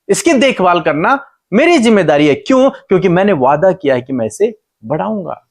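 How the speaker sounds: high, emphatic, insistent preacher's voice; God reasoning with Hagar. Retold or imitated. God explains His promise to crying Hagar, high insistent reasoning. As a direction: press each question and promise home, pitched high. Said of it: "परमेश्वर उसे समझाने की कोशिश".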